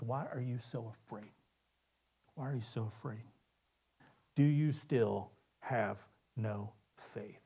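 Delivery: soft, low gentle rebuke. Acted. Jesus to frightened disciples, soft gentle rebuke. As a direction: quietly ask why they fear. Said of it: "he turns to his disciples, and he says".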